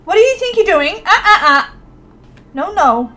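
loud, high telling-off; mock scolding. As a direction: scold him like a naughty child. Scolding sim for waking her.

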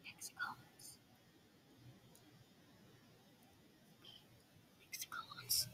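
breathy secret whisper. Real, not acted. Player whispering a guess to himself, hushed. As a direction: murmur it under the breath.

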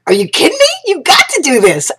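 high, excited mock exclamation; excited disbelief. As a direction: urge her on in excited disbelief. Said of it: "And I'm like".

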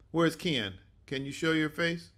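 ordinary talk

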